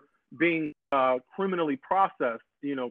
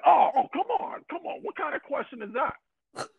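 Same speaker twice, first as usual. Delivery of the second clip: mock indignant believer; 11 semitones higher than usual.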